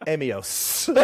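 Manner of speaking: "Amios" as AY-mee-ohss; joke three-syllable pronunciation. Pretend. Joking with co-host about the name, "Amios" in three syllables. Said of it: "put a third syllable in Amos".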